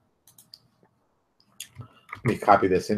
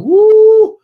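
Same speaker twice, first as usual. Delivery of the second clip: much higher, much louder cheer; excited whoop; 13 dB louder than usual. Retold or imitated. Audience leaving a great trailer, loud high whoop. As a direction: whoop like a thrilled audience member. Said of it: "People should leave it going".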